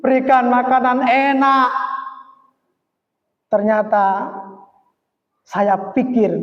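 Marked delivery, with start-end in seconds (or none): 0.0-1.7 high, sustained call to mum; acted, moderately raised shout; imitated child's yell. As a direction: call out like a child demanding food. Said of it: "anak saya teriak-teriak".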